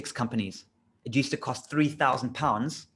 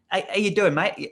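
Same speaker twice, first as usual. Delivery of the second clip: put-on higher, louder English accent slipping into Australian; botched English accent; 5 semitones higher, 4 dB louder than usual. Cold-calling a mate on the phone, bad English accent. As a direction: attempt English, land nearer Australian. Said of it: "got to do this in an English accent".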